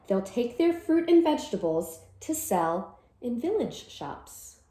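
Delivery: ordinary talk, nothing marked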